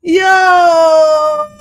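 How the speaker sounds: high laugh breaking into a loud yo; cracking up. Real, not acted. Laughing at [name]'s lyrics, a loud cracked-up yo. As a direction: lose it laughing, then call out.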